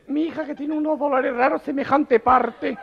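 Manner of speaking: high, slow falsetto mother; high-pitched mother talking to the doctor; mother's voice. Acted.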